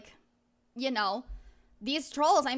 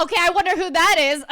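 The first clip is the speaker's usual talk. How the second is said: excited; 5 semitones higher, 12 dB louder than usual. Real, not acted. Still thrilled by donations.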